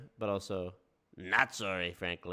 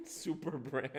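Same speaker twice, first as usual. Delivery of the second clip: slow, laughing through the words; mock the phrase while cracking up; 4 semitones higher, 4 dB quieter, slower than usual. Real, not acted. Streamer cracking up at the host's phrase, laughing. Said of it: "how ridiculous this shit sounds".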